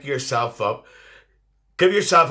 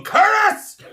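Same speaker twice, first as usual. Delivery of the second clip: very high, loud mock yell; imitated call; 5 dB louder than usual. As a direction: shriek the name as someone calling out. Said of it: "Tipper Knight's going".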